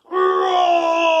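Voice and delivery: loud shouted dragon roar; dragon fire roar. Pretend. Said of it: "let's let out a big dragon roar".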